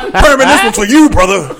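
loud, high, laughing wrestler shout-out; wrestling promo. Retold or imitated. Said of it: "he cut a wrestling promo".

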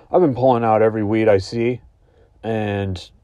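ordinary delivery

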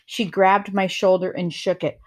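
plain reading voice

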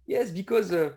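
high, bright playful squeal; playful excited. Real, not acted. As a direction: tease it, voice way up. Playful joking about tasty nuggets.

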